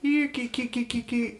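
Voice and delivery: high squeaky kee kee calls; cat calling noise. Acted. Druid squeaking to call a cat over. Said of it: "bend down and say".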